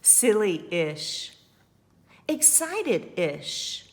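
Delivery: story narration. A narrator's reading. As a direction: read it to the children with energy. Reads the story aloud.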